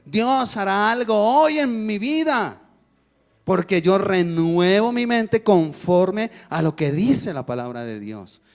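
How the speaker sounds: declaring faith. Retold or imitated. Doctor teaching patient to declare faith, slow and fervent. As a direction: proclaim healing with conviction.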